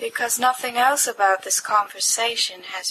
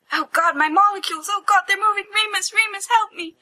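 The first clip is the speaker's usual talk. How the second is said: hammed-up terror at moving molecules; mock panic; 9 semitones higher than usual. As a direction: cry out for help in silly alarm. Stoned panic about molecules.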